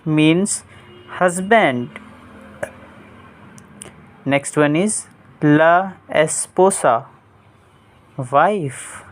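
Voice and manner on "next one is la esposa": ordinary delivery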